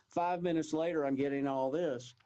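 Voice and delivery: usual speaking voice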